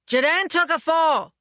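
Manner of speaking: high, loud alarmed shout; an assistant director shouting for the wranglers, horrified; horrified call. A narrator's reading. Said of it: "in horrified tones".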